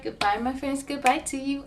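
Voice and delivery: ordinary delivery, nothing marked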